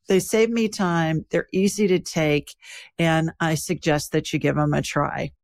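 calm, even sponsor read. Acted. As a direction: read the ad steadily and clearly. Host reads sponsor ad.

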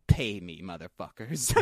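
low-pitched tough-guy demand; retold demand. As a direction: drop the voice and lay down terms.

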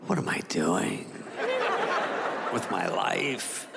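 a dog pondering his sad life, half-hearted; mock existential dog.

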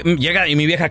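ordinary talk, nothing marked

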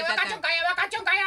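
high-pitched, loud scary PE teacher; 北村先生. Acted. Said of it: "梶原一騎さんによく似てる怖い体育の先生". (怖い体育教師の甲高い怒鳴り声)

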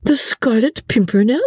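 put-on girl delighted by an odd name, lightly; merry amusement. A narrator's reading. Suzanne's character reacts to the droll name, merry amusement. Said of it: "with a merry laugh".

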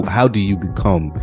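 ordinary talk

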